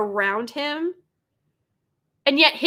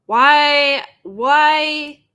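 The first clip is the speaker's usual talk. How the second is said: loud, raised, exasperated wail; exasperated cry; 3 semitones higher, 9 dB louder than usual. Real, not acted. Viewer reacting to a trailer scene, loud exasperated cry. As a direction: wail it out in disbelief.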